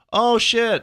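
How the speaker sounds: sudden high outburst, mock amazement; bar patron reacting. Imitating bar crowd glancing up at the movie, mock shock. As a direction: exclaim like a startled drinker at the screen. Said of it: "people were like".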